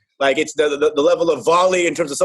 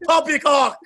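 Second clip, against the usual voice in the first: mock British outburst; 7 semitones higher, slower than usual. Imitating Ramsay blooper outbursts.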